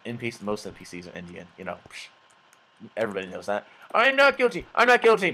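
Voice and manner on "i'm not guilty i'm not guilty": high, loud mock protest; hammed-up, high-pitched plea; protesting prisoner. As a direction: plead innocence in a high prisoner voice. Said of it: "They'll be like".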